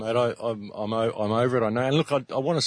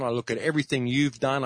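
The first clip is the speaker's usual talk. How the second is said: normal talking voice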